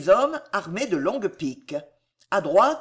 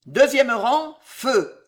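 loud, raised military order; sharp officer's shout; firing command; 5 semitones higher, 5 dB louder, slower than usual. A narrator's reading. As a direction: call the volley with authority. Officer orders the next volley.

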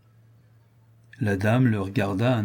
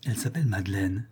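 softly murmured to himself; 2 semitones lower, 5 dB quieter, faster than usual. Acted. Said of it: "murmura Richard".

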